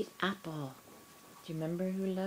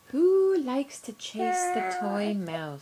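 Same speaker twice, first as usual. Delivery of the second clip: excited; 3 semitones higher, 6 dB louder than usual. Real, not acted. Gasp, excited question to baby.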